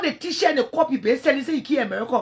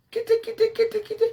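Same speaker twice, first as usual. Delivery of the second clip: high taunting kitty kitty; sing-song higher taunt; mock cat call; 10 semitones higher, faster than usual. Real, not acted. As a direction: call her like a stray cat. Calling rival a cat.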